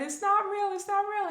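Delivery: high pleading denial; denial in panic. Retold or imitated. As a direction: refuse to believe it, high-pitched. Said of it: "flipping out".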